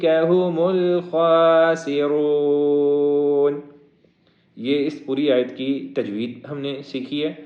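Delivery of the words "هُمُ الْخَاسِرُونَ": slow melodic Quran chant; chanted Quranic recitation, long and melodic; tajweed recitation. Shown as a demonstration. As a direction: draw each word out in sung recitation. Full verse recitation.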